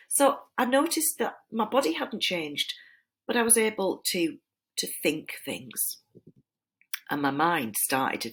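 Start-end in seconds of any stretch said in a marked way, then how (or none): none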